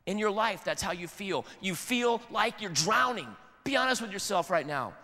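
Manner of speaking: ordinary talk, nothing marked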